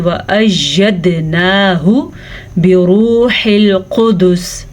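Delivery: slow, drawn-out tajweed recitation; Quran recitation. Shown as a demonstration. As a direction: chant each word slowly. Recites the verse.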